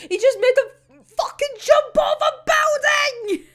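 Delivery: high, loud, wailing indignation; outraged outburst. Real, not acted. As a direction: shout it in disbelief, pitch way up. Outraged at the author.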